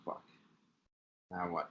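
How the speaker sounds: ordinary delivery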